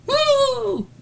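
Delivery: very high, loud "woo"; mock whoop. Capping a rant at the think tank, mock whoop. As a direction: let out a long high whoop. Whoop after mocking their demands.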